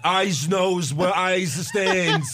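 put-on overdone, slow New York accent; Cass's New York accent. Mocking a wrestler overdoing it, exaggerated New York accent. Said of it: "trying to do his New York accent".

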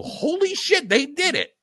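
high-pitched mock astonishment; amazed. Fan imagining the Dodgers finally winning, amazed. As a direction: act out stunned disbelief, voice leaping up. Acts out future reaction to a win.

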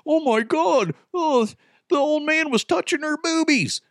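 high mocking shocked prude voice; a scandalized busybody, high and shrill-free. Host mocking prudes, high scandalized voice.

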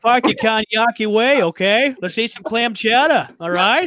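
put-on thick Boston accent, playful and loud. Host joking to co-hosts in a hammed-up Boston accent. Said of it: "in a Boston accent".

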